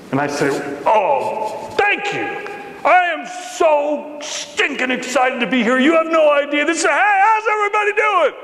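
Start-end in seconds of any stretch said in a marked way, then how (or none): 0.8-7.2 much higher, gushing newcomer; breathless over-the-top delight at arrival; overexcited gush. Acted. Eager newcomer bursting into room. 7.2-8.3 much higher, fast shouted greeting; eager shout. Acted. Newcomer greets the crowd loudly.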